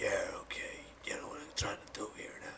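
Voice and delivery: low, whispered movie tough-guy voice; Clint Eastwood. Acted. Showing friend an actor's Batman-like accent, whispered impression. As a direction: drop into a hushed, quiet screen growl. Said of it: "or Clint Eastwood".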